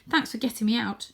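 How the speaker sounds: plain reading voice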